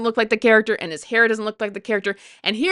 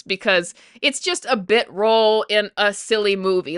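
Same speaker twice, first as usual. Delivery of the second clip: normal talking voice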